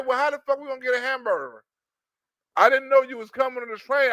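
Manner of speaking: ordinary delivery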